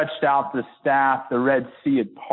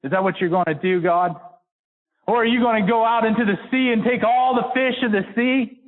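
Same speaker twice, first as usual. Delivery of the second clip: higher and higher, incredulous questions to God; Moses questioning God; 7 semitones higher than usual. Retold or imitated. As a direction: Moses pressing God in disbelief. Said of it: "He says".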